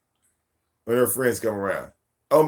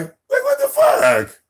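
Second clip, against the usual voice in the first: much higher, slow shocked squeal; hammed-up outrage; incredulous; 6 dB louder, slower than usual. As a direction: stretch it out in disbelief. Outraged at kids' mischief.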